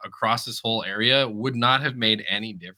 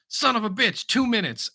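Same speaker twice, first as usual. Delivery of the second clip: higher-pitched imitation of exasperation; mock frustrated curse; 8 semitones higher than usual. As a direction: imitate a driver cursing a delay. Retold driver cursing a delay.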